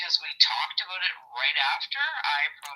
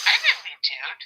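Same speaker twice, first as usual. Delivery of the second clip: laughing; 8 semitones higher, 7 dB louder than usual. Retold or imitated. Wry joke told to Colby.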